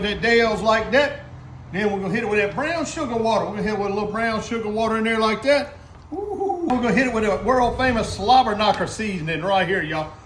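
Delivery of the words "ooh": high-pitched ooh; a high "ooh" over good food; appreciative ooh. Real, not acted. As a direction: let out a high, delighted ooh. Reacting to seasoning going in.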